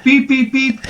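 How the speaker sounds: high beeping imitation of a bike computer; mock computer beeps. Mimicking the bike computer going off course, high beeps. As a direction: beep like the GPS.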